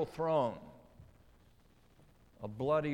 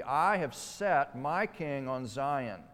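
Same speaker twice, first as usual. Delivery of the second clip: slow, louder proclamation with weight on "I"; emphatic I; 3 dB louder, slower than usual. Shown as a demonstration. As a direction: lean hard on "I", drawing out "I have set my king on Zion". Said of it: "The "I" is emphatic".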